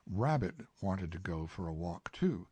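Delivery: ordinary delivery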